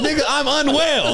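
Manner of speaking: high, laughing mock voice of the man; imitating the homeless guy. Mocking the homeless man's line while laughing. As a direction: ham up a crazy man's claim through laughter. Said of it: "He goes".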